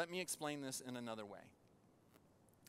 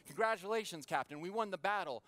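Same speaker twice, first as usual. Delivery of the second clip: higher, louder retold cheer; excited congratulations; 5 semitones higher, 6 dB louder than usual. Sailor congratulates his captain on winning the battle, excited. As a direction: announce the victory brightly, raised in pitch and volume.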